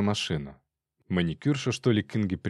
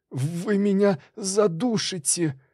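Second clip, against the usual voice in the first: high, slow choked squeal; thin high strangled voice, dragged out; strangled wheeze; 10 semitones higher, slower than usual. A narrator's reading. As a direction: squeeze it out as if being throttled. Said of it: "просипел преступник".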